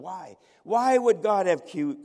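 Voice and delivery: loud, high, slow demand; crowd's outcry. Retold or imitated. All the witnesses demand why of God, loud outcry. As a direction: voice a whole crowd's protest, drawn out. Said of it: "they all responded in unison".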